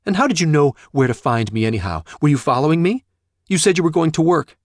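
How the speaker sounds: loud, high, fast demanding questions; angry accusation. Acted. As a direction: hurl the accusations at her father. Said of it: "her anger revived".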